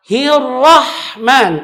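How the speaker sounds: slow hirrahman, heavy ra; correct recitation. Shown as a demonstration. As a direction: Hirrahman with thick ra. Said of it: "huruf ra itu tebal". (Hirrahman dibaca benar)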